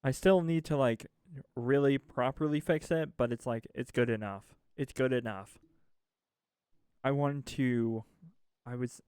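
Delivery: ordinary talk, nothing marked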